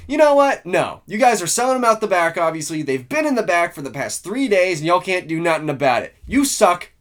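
ticked-off rant. Retold or imitated. Shopper snapping back at the Walmart clerk, angry and loud. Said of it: "that ticked me off".

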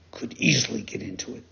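fast spooky whisper. Acted. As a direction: whisper the scary end quickly. Dolphin's scary ending.

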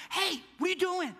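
high-pitched imitated complaint; mock indignant. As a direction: protest like an interrupted golfer. Imitating annoyed golfer about to putt.

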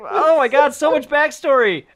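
high, laughing eye-roll; mock exasperation. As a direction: pretend to be overwhelmed by a boring story. Mocking the film's dull exposition.

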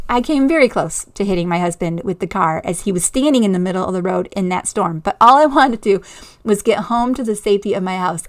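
ordinary talk, nothing marked

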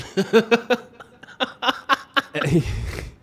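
loud burst of laughter; big laugh. Real, not acted. Laughing while telling a friend the story. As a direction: crack up at the memory.

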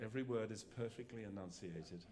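a hint of posh BBC English; BBC English accent. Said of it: "talk with my best B B C accent".

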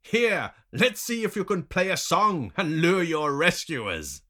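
high, loud captor's taunt. A narrator's reading. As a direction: dare him mockingly at full voice.

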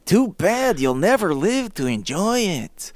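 high cartoon villain voice; Ren and Stimpy voice. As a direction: sneer it like a cartoon. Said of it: "That's like Ren and Stimpy".

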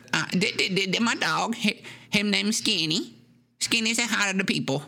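put-on stammering high old man; stuttering odd host; Horace voice. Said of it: "Horace says".

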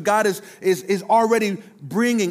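ordinary talk

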